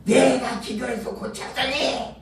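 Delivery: a hint of a bragging pastor, 내가 leaned on; lightly mock the pastor's self-praise; boasting pastor. Preacher mimicking a boastful pastor claiming the healing. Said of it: "또 나중에 뭐라 그러냐면".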